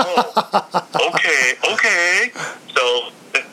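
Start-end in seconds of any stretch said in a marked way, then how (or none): 0.0-2.3 high, laughing concession; a hint of an amused producer; laughing okay. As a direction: give in with a chuckle. Producer won over, laughing.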